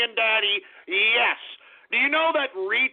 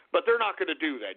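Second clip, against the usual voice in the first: ordinary talk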